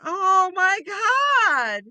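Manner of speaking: drawn-out, astonished "oh my God"; amazed realization. Retold or imitated. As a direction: relive the moment it suddenly clicked. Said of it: "And I thought".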